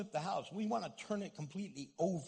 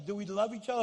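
usual speaking voice

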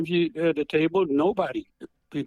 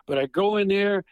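usual speaking voice

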